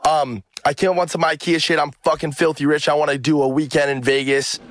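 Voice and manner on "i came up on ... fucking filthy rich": ordinary talk, nothing marked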